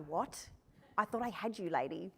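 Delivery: a hint of a bewildered boy; stunned boy. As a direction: let the boy's plan fall flat. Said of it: "He was like".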